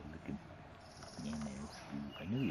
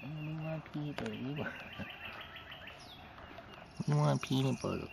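lightly voiced squirrels, fast, higher and softer; mock critter voice; 6 semitones higher, 9 dB quieter, faster than usual. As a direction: speak for the squirrels begging for treats. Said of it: "be telling you".

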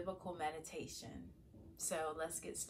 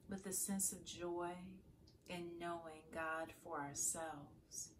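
calm, softer meditation voice with long pauses; guided meditation; 7 dB quieter than usual. Acted. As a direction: guide listeners gently into prayerful stillness, leaving space to breathe. Leads a morning meditation.

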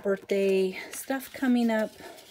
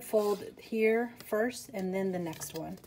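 usual speaking voice

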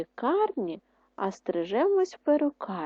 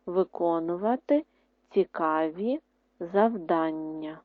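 word by word, slow pauses; slow dictation; 2 semitones lower than usual. Shown as a demonstration. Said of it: "Третє". (диктант по слову)

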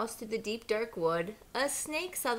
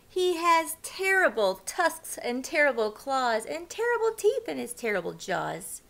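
louder, bold little mouse bragging; mouse boasting; 3 semitones higher, 7 dB louder than usual. A narrator's reading. Mouse scaring the fox with Gruffalo tales, bold and loud. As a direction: puff up the monster to scare the fox. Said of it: "But the mouse said".

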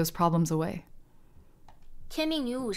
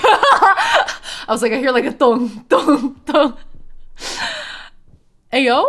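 laughing; 8 semitones higher, 8 dB louder, slower than usual. Real, not acted. Laughing at soundtrack.

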